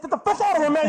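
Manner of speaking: high-pitched, hammed-up dismissal; mock angry yell. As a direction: act chasing a customer off. Acted staff chasing customer away.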